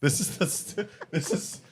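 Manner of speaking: high, halting, then loud outburst; stumble, then protest loudly through laughter; emotional, laughing protest. Real, not acted. Host overcome by emotion, flustered laughing protest. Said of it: "I was getting emotional".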